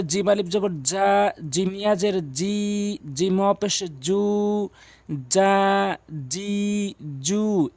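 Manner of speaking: "জা, জি, জু" from mid-tongue against palate, vowels long; slow letter drill ending on held "জা, জি, জু"; jim madd drill. Shown as a demonstration. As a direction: spell out, then stretch "জা", "জি", "জু". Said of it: "এভাবে পড়বেন".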